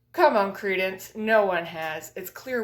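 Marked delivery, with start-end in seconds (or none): none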